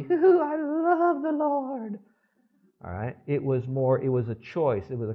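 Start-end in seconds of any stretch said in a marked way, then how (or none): 0.0-2.0 high, slow falsetto gush over Hoo-hoo; mock giddy gushing. Preacher mocking emotional love to congregation, high giddy gush. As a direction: ham up a swooning Hoo-hoo, mocking shallow feeling. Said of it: "was not the".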